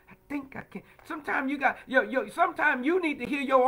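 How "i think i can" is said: lightly voiced little engine, softer; a hint of a small train straining uphill. Preacher repeats the little train's self-talk, softly straining. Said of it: "trying to make it up the hill".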